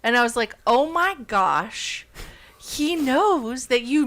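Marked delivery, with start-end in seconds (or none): none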